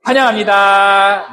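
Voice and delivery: drawn-out high welcome; slow bright welcome. Shown as a demonstration. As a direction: stretch the welcome slowly and warmly. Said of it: "이렇게 하는 거예요". (천천히 밝게 "환영합니다")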